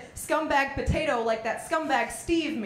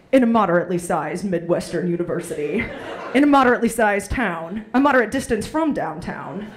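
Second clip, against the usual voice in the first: low, loud trailer narrator; mock-epic voice-over; movie trailer voice; 5 semitones lower, 6 dB louder than usual. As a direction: announce it like a blockbuster trailer, big and deep. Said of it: "your life should be a movie trailer".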